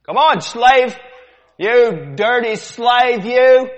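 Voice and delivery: slaver shouting. Acted. Sailor yelling at a slave to line up. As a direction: bark the slave back into line. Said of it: "The demonstration".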